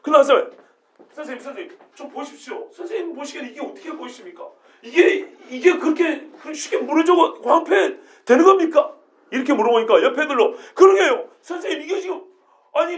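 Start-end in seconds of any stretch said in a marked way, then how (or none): 1.1-4.5 very soft, careful asking; hushed nervous request; timid hushed plea. Retold or imitated. Disciples secretly asking teacher. 4.8-8.9 slow, higher, louder, laughing disbelief; rising laughing outrage; higher laughing objection; incredulous protest. Retold or imitated. Disciple protesting in story.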